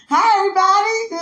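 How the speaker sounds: high, loud sing-out hello; excited greeting. Retold or imitated. Acting out her freshman-year greeting.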